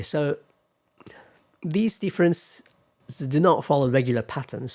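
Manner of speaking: usual speaking voice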